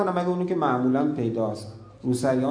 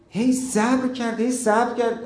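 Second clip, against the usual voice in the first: rising urgent repetition; 8 semitones higher, 4 dB louder than usual. Acted. Musa's patience building up.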